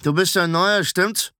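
unfriendly senior apprentice; hostile. A narrator's reading. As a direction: size up the newcomer with suspicion. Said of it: "schaute ihn feindselig an".

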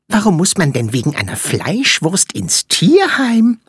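high, quick little flea voice; squeaky tiny creature. Acted. Flea character speaks.

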